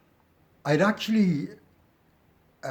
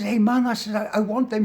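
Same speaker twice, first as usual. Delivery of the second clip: high, raised-voice objection; urgent protest; 10 semitones higher, 6 dB louder than usual. Retold or imitated. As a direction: act out pleading to keep your things. Acted protest over binned belongings.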